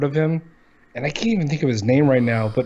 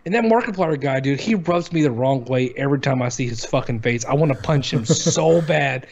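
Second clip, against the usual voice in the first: normal talking voice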